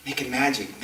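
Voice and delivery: ordinary talk, nothing marked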